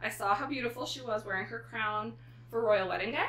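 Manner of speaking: usual speaking voice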